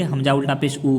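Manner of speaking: quick, with a short "ऊ"; unstretched reading. Shown as a demonstration. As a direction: say "ऊ" briefly, without holding it. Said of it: "अगर हम ऐसे ही पढ़ देंगे". ("ऊ" बिना खींचे, जल्दी में)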